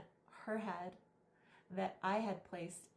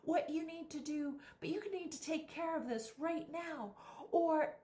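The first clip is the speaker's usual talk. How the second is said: mock yell, much higher and pushed, down the phone; imitating a phone yell; 9 semitones higher than usual. Guy at the club door yelling into his phone, imitated high mock yell. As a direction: bark orders at someone on the other end, pitched way up. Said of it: "he's yelling on his phone".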